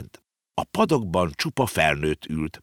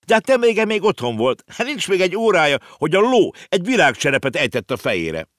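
excited; 13 semitones higher, 6 dB louder than usual. A narrator's reading. Konrád excited, stammering.

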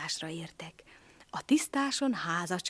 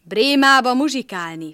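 proud; 4 semitones higher, 11 dB louder than usual. Acted. Animals answer cat.

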